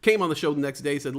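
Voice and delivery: ordinary talk, nothing marked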